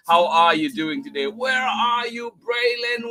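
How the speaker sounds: normal talking voice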